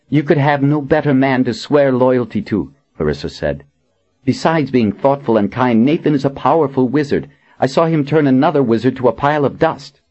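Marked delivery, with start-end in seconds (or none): 0.1-2.7 put-on young woman voice. A narrator's reading. Clarissa voiced higher. 4.2-10.0 higher, quick admiring voice; eager young woman. A narrator's reading.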